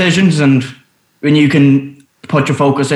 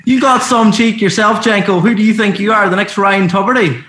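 cheeky retort, higher; playful comeback; 6 semitones higher than usual. Acted. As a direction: fire the joke straight back. Jokey comeback, pitched high.

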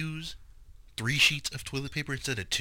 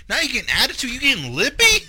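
raised pitch, mock-tough challenge; mock confrontational; 11 semitones higher, 4 dB louder than usual. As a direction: needle him like a touchy squadmate. Mock aggressive military buddy.

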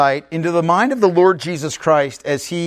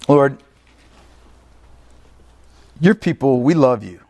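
earnest prayer with long pauses between phrases; 4 semitones lower than usual. Real, not acted. Preacher prays to the Lord for the people, prayerful.

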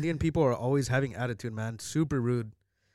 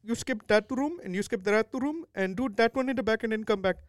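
high-pitched mock Indian accent; imitating an Indian-accented homeowner; heavy Indian accent; 8 semitones higher than usual. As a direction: put on a thick Indian accent, giving orders. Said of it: "he had a mega seven eleven accent".

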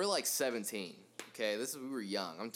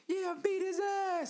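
very high, drawn-out falsetto cheer; imitated onlooker lady; 4 dB louder, slower than usual. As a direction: egg on a fight as a squealing bystander. Imitates lady cheering from across street.

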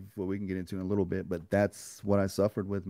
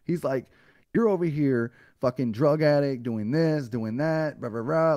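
louder, higher scolding retold; tough love; 4 semitones higher, 5 dB louder than usual. Retelling the caller scolding a self-pitying addict, loud and stern. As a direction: chew him out like a drill sergeant. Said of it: "giving me some tough ass love".

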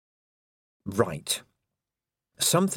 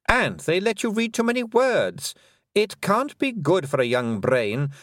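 higher, silky voice of a mocking fairy queen; lightly higher, condescending queen; 6 semitones higher, 4 dB louder than usual. A narrator's reading. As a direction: purr it out as the cruel Queen, sweetly taunting.

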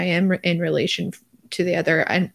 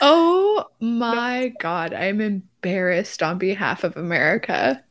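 very drawn-out, appalled "oh my God"; mock embarrassment; slower than usual. Real, not acted. American embarrassed for her country, drawn-out mock horror. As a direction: stretch the opening out in mock horror.

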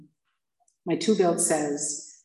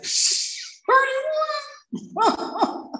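high, astonished; wide-eyed disbelief at thirty-one; amazed gasp. Retold or imitated. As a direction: squeak it out in disbelief. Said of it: "you're like".

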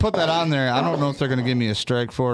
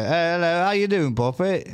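chirpy higher-pitched British greeting; British accent; 6 semitones higher than usual. Retold or imitated. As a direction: open in a put-on British accent. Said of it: "like, in your British accent".

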